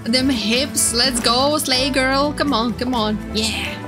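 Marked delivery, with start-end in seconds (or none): none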